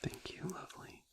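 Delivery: a barely audible whispered thanks; soft whisper. Acted. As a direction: breathe it into their ear. After the hug.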